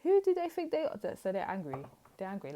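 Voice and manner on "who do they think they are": mock outrage rising higher and louder to a shriek; outraged ex. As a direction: play the offended ex who has just been blocked, climbing up. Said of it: "they're like".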